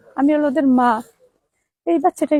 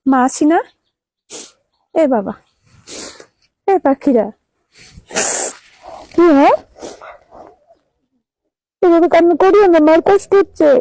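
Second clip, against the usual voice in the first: higher and louder, begging them to stop; raised, pleading mother voice; 3 semitones higher, 5 dB louder than usual. Real, not acted. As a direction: plead over the crying. Pleading with children to stop crying.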